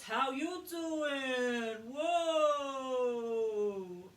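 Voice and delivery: loud, stretched-out call of how you doing; calling out greeting. Shown as a demonstration. Coach demonstrating resonance, loud slow hailing call. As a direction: hail someone across a room, slowly. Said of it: "what's the one we do for this".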